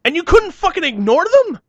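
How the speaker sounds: outraged shouted question at the director; incredulous shout. Retold or imitated. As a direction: yell it in total disbelief. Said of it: "And I'm just like".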